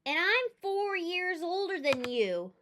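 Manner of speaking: cocky big-brother voice; boasting brother. Acted. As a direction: lord it over her.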